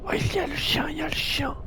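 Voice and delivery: high, hushed whisper; whispering while hiding. Real, not acted. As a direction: whisper in alarm. Spots dog while hiding.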